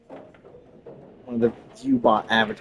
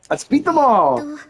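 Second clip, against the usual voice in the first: high, rousing shout; rally everyone, pitched way up; excited battle cry; 13 semitones higher, 4 dB louder, slower than usual. Acted. Playful battle cry reading.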